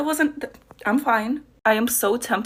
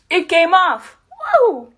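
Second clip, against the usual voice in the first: excited; 9 semitones higher, 7 dB louder, much slower than usual. Real, not acted. Prosthetic finally removed.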